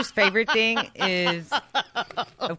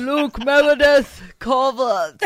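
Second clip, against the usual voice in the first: slow, loud, higher shouted full name; 6 semitones higher, 10 dB louder than usual. Real, not acted. Coworker shouting the baby's full name across the office. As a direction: bellow the full name for the whole room.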